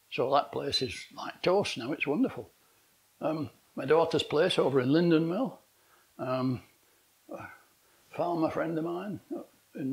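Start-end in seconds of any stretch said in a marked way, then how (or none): none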